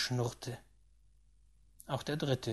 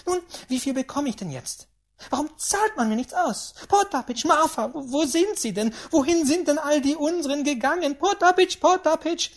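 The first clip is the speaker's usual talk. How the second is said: high, loud flurry of calls; flustered, demanding old lady; agitated great-aunt; 12 semitones higher, 8 dB louder than usual. Acted. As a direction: call out excitedly for the servants.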